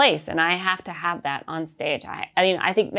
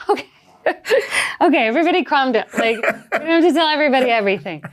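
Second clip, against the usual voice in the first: higher, louder and fast through laughter; laughing, hushing son; 7 semitones higher, 5 dB louder, faster than usual. Retold or imitated. Mother calming her chatty son on a plane, laughing. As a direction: shush the kid while cracking up. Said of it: "And you're like".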